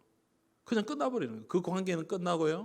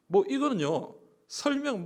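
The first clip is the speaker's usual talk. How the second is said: usual speaking voice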